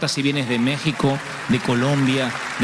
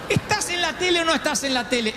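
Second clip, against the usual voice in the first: put-on old mother's voice; 13 semitones higher than usual. Mother insists.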